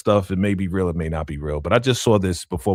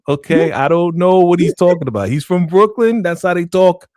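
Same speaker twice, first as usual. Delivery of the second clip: raised, louder, put-on formal speech to Kim; mock respectful address; 6 semitones higher, 5 dB louder than usual. Cohost speaking to Little Kim over here, mock formal. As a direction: talk straight to Little Kim like she's listening.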